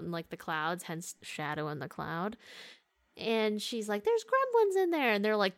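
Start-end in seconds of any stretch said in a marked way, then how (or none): 4.0-5.1 much higher, mock-panicked cry; alarmed warning. Retelling the heroine alarming the crew, high mock panic. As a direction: squeal it like she's spotted monsters.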